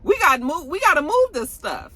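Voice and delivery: high-pitched and urgent, pushing back; high excited protest. Retold or imitated. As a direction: squeal it out as the Castillo brothers.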